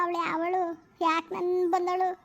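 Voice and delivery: ordinary talk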